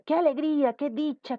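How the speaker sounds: high-pitched model exclamations; exclaiming. Shown as a demonstration. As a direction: list them as little cries of joy. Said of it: "Queremos exclamar". (exclamando con alegría de ejemplo)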